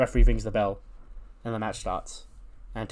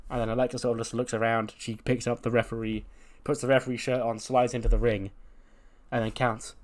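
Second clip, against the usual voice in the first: usual speaking voice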